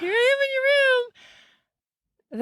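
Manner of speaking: high, slow sing-song hello; high breezy mock greeting; cheery greeting. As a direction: greet a friend brightly and lightly. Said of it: "she's like".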